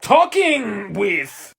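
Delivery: ordinary delivery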